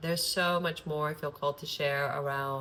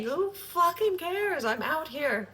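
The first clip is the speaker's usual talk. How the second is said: defiant; 11 semitones higher, 3 dB louder, slower than usual. Real, not acted. Defiant outburst about strategy.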